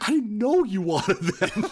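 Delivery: emotional sample lady. Retold or imitated. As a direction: plead like a hurt saleswoman.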